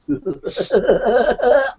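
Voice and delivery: high, manic villain laugh; villain cackle. Acted. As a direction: cackle like a mad clown villain. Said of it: "You sound a bit like Joker".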